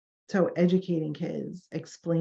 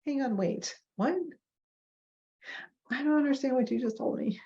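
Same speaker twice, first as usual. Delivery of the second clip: higher, hesitant client voice; awkward confusion; 8 semitones higher, 3 dB quieter than usual. Acted. Client awkwardly asking clinician to explain, confused. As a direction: imitate a puzzled client interrupting. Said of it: "It's really awkward to be like".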